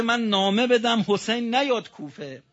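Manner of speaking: high, loud mocking retort; scornful outcry. Preacher mocking Ibn Ziyad's refusal, loud. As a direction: throw the tyrant's words back in anger.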